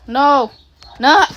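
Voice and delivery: loud high protest; dismayed shout. Real, not acted. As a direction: cry out no in dismay. Upset reaction in the game.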